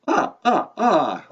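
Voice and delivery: high staccato vampire chuckle; three separate "ah" laughs, starting high; Count's laugh. Acted. Count chuckling at the host, staccato vampire laugh. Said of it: "You don't have to laugh after everything you say".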